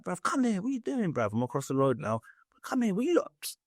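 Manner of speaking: high called-out bruv across the road; calling out. Retold or imitated. Calling his mate laughing with the police, high call across road. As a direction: call your mate over from across the street.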